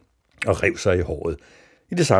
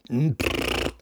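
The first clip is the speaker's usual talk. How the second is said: lips blown in a buzzing sputter; raspberry noise; 8 semitones higher than usual. A narrator's reading. Mads answers into the telephone, blown raspberry. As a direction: blow a rude noise down the line. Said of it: "Sådan en brud".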